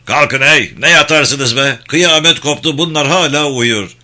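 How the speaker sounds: loud, high bellow at sleepers; shouting to wake. Acted. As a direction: yell them out of bed. Commander yells soldiers awake. (bağırarak uyandıran komutan sesi)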